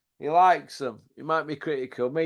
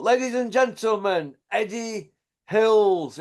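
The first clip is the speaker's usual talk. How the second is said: ring announcer; 7 semitones higher than usual. Pretend. Mock ring announcer intro.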